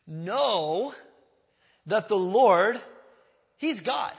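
Know, that the Lord, he's God with loud pauses between; slow weighty reading. Shown as a demonstration. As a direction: lay each phrase down with a pause. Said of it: "Just pause there for a second".

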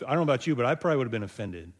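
normal talking voice

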